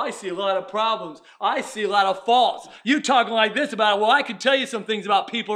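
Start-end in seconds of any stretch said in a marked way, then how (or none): none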